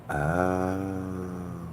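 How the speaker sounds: ordinary delivery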